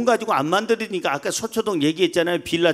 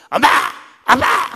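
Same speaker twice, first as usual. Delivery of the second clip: loud, high mock shriek for mom; imitated child's shrieking; 8 semitones higher, 8 dB louder than usual. Father imitating his child calling mom, high mock shriek. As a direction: yell out like a noisy kid. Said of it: "이거 건강한 날은 그냥 소리 빽빽".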